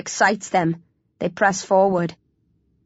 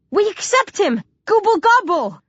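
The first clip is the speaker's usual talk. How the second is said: insistent plea; 12 semitones higher than usual. A narrator's reading. Hero chants a remembered line in plea.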